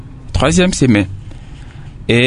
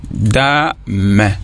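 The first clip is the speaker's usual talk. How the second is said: "Ma", "da", "me" as three separate beats; syllable by syllable; 4 semitones lower, much slower than usual. Shown as a demonstration. Teacher having pupils count the parts, syllable by syllable. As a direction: say "Ma", "da", "me" with a short break after each. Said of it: "C'est formé de combien de syllabes?".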